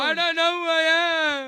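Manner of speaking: loud, hammed-up wail of self-pity; mock a believer crying at home; loud mock moaning; acted lament. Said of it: "when you go home and cry".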